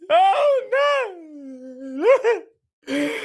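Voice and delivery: long, high fake wail; mock crying. Friend asked if it's the Giants, mock wailing. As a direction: draw it out into hammed-up bawling. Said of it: "I don't know why I'm crying".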